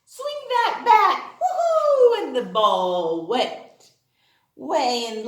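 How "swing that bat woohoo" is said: high, loud, slow with laughter; laughing cheer. Acted. Teacher cheering a pretend swing for kids, high and laughing. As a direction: whoop like the ball flew out.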